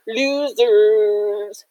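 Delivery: sing-song bully jeer; jeering taunt. A narrator's reading. Jefferson kids jeer at their rivals, taunting. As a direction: taunt them from behind cover.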